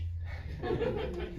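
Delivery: very high breathless imp giggle; tiny sneering imp giggling; giggly sneering imp. Acted. Imp voice giggles after line.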